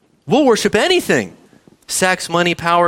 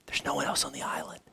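whispered aside; 17 dB quieter than usual. Acted. Whispered aside in island illustration.

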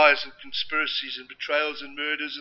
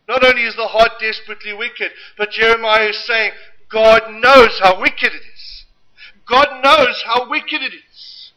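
preaching; 4 semitones higher, 9 dB louder than usual. Acted. Preaching at full force.

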